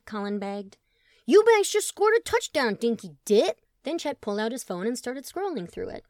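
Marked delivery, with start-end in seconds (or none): 1.3-3.6 high, loud triumphant whoop; excited cheer. Acted. Chet whooping at the TV over a touchdown, high and loud. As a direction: celebrate the touchdown at the screen.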